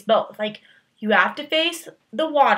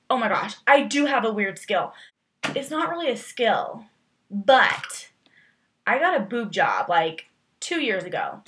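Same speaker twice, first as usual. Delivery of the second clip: ordinary talk, nothing marked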